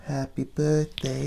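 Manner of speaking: very slow, hushed sing-song; soft Marilyn voice. Retold or imitated. Host mimicking Marilyn Monroe for his guest, soft voice. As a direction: imitate her softly, drawing out each word. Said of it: "with that, that soft voice".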